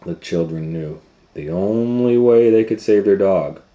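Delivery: usual speaking voice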